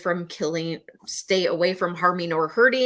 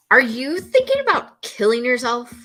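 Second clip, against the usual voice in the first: higher, louder, pointed ask; blunt direct question; 6 semitones higher, 5 dB louder than usual. Shown as a demonstration. Trainer demonstrating the blunt way to ask, loud and pointed. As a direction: fire the question straight out. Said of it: "Those are very different".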